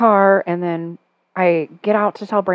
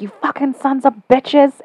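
higher, drawn-out mock yell; retold angry swearing; 7 semitones higher than usual. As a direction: imitate the neighbor cursing at the dogs. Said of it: "swearing, like".

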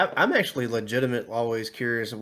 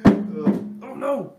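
rising mock horror; a dramatic oh no; mock dismay; 9 semitones higher, 6 dB louder than usual. Real, not acted. Reacting to broken guitar.

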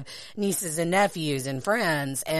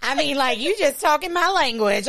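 ordinary talk, nothing marked

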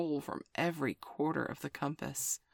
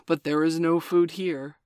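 louder, stiff and grudging; 8 dB louder than usual. Acted. Wolf grudgingly admits the jackal, stiff. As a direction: let him in coldly. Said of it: "said Father Wolf stiffly".

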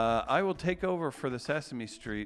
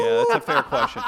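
laughing; 12 dB louder than usual. Real, not acted. Laughing agreement.

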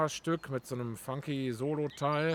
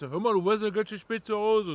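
higher, louder put-on voice of a nagging neighbor; mock nagging neighbor; 8 semitones higher, 8 dB louder than usual. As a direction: play the nosy neighbor complaining. Neighbor scolding him in the morning. (spöttisch nachgeahmter Nachbar, höher und lauter)

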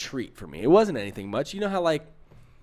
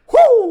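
high, loud whoo; retold whoop; 15 dB louder than usual. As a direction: let out a big whoop of nervous relief. Reacts to the intense look.

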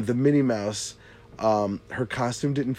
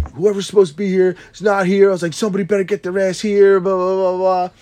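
raised, louder mock yell at the no-show; replaying how he chewed her out, loud; retold yelling; 7 semitones higher, 7 dB louder than usual. Telling a friend how he yelled at the face painter, louder. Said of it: "I'm, like, yelling at this woman".

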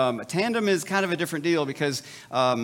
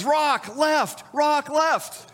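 wife in the canoe front calling to husband, mimicked high; imitating the wife; 11 semitones higher, much slower than usual. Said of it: "she says".

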